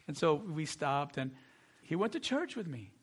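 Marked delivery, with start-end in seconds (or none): none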